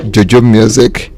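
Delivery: ordinary delivery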